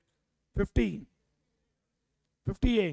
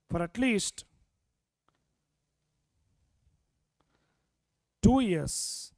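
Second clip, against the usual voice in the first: very slow, word by word; slow dictation; much slower than usual. Shown as a demonstration. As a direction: spell out each phrase for notes. Teacher dictates the legal condition.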